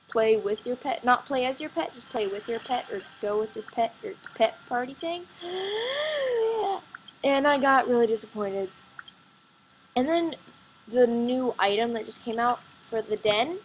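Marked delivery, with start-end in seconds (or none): 5.3-7.2 a high, breathy gasp; sharp gasp. Real, not acted. Gamer reacting to a letdown item, a sharp gasp. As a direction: gasp in dismay.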